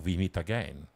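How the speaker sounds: put-on a villain's slow European-accented greeting; generic European accent. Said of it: "in a generic European accent".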